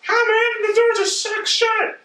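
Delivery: mocking high falsetto character voice; squeaky high voice. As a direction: read the subtitle in a silly squeak. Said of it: "such a high voice".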